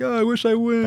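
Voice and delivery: high mock whine; whiny mimicry. Streamer imitates viewers who wish they won, whining. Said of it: "Everyone's like".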